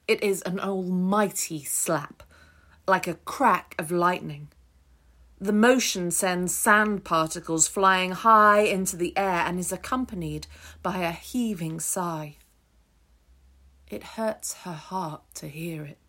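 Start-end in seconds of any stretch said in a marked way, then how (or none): none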